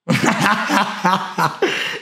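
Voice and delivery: high, real cackling laugh; burst of laughter. Real, not acted. Streamer cracking up at a caller, high laughter.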